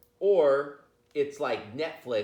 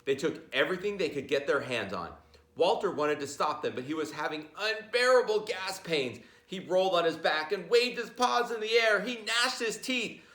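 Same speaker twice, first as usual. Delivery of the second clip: higher, building tension for the class; rising storybook narration; 8 semitones higher than usual. A narrator's reading. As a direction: tell it as the pains get worse. Narration climbs toward climax.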